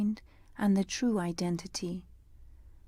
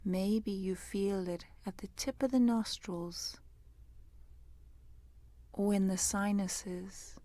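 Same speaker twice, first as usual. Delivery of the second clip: guided meditation; 6 dB quieter than usual. Real, not acted. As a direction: guide them gently into the breath, unhurried and hushed.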